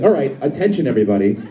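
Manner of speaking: usual speaking voice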